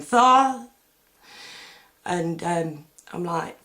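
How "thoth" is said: a sudden high, loud cry of the name on waking. Retold or imitated. Woken from a vivid dream, crying out the name loud and high. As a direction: blurt the name out as if jolted awake. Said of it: "I woke up going".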